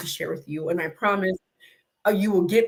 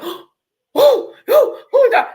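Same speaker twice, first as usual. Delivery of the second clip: much higher, louder panicked oh my God; retold terror, high and loud; panicked shriek; 14 semitones higher, 9 dB louder than usual. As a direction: squeal like a huge dog is charging. Retold panic as dog gallops.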